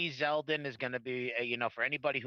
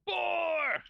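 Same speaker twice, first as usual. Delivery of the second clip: much higher, frantic play-by-play call; frantic mock sports commentary; 4 dB louder than usual. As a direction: shout it like a ringside announcer counting. Count continues.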